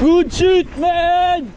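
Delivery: excited cheer. Real, not acted. Excited praise while riding.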